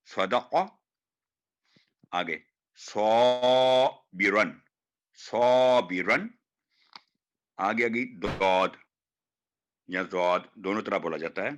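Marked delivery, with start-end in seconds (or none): 2.8-6.3 सौबिरन with a thick, heavy सौ, slow and drawn out. Shown as a demonstration. Teacher shows students the heavy सौ in सौबिरन. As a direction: give the सौ in सौबिरन a full, heavy sound. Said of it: "काफी मोटी आवाज में".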